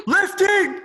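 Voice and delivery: high, loud chant-leader call; hyped crowd warm up. Acted. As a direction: shout the cue to fire up the crowd. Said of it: "when I say lifting, you say things".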